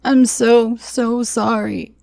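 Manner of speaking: high, slow broken sobs; breaking down in tears; sobbing apology. Acted. Brett sobbing his apology to the girl. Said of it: "he cried".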